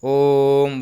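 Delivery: ओम stretched long and slow; drawn-out Om. Shown as a demonstration. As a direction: hold the ओम like a chant. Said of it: "इसमें तीन गुना समय लगता है". (ओम को तीन मात्रा तक खींचकर)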